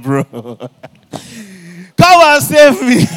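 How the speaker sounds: very loud, slow, stretched cry for help; calling his friend to rescue him, laughing; desperate laughing plea. Retold or imitated. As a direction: wail it at your friend, half laughing. Laughing panic call to friend.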